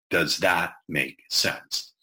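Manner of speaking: very slow, drawn-out question. Shown as a demonstration. Speaker on stage asking the audience for questions, very slow. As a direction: ask it slowly, giving room to answer. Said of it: "when I ask for questions, I'll say".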